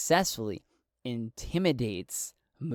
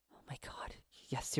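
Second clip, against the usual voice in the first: frightened whisper; 13 dB quieter than usual. Acted. Frightened woman realizing danger.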